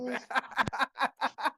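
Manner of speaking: real high-pitched laughing fit; helpless high laughter; uncontrolled laugh. Real, not acted. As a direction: burst out laughing, high, can't stop. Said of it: "Sorry, I gotta stop laughing".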